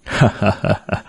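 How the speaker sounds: a soft, short crisp laugh, lightly done. A narrator's reading. Customer laughs at Nguyệt's threat, soft crisp laugh. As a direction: chuckle crisply but quietly. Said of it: "Khách cười giòn tan".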